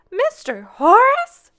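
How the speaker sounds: very high, loud, drawn-out cry; shocked. Acted. Grandmother learning Horace stole the recipe, shocked cry. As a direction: shriek his name in disbelief.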